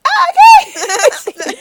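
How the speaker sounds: very high, loud, stretched mock squeal; voice shooting way up. Retelling a friend's flustered reply to a fan, voice way up. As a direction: imitate someone squeaking it out, laughing. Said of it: "Her voice goes, like, way up".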